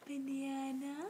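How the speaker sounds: usual speaking voice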